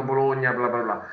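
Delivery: ordinary talk, nothing marked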